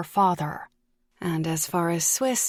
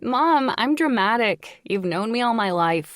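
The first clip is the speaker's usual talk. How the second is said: exasperated retort; 4 semitones higher, 4 dB louder than usual. A narrator's reading. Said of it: "she returned".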